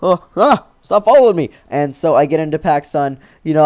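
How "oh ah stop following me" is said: higher and louder, a startled gasping plea; teen spotting the cop behind him, panicked; mock panic. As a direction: yelp it as if caught. Gasps, cop following him.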